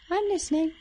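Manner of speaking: very high, squealed creature voice; odd character voice. Acted. Swamp character ranting about town newcomers, in an odd high voice. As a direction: push it up into a strange squeaky puppet. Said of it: "what that voice is".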